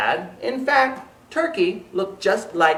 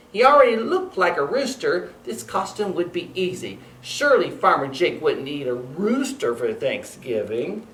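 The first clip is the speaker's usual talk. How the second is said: usual speaking voice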